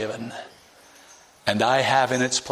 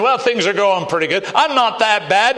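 higher, louder, airy self-satisfied tone; mock complacent rich man; 7 semitones higher, 4 dB louder than usual. Preacher mocking a complacent rich man, higher and louder. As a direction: toss it off like a smug man who thinks he's fine.

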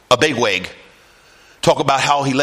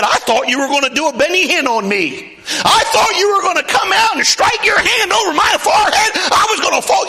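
Naaman's complaint; 14 semitones higher than usual. Acted. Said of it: "That man said".

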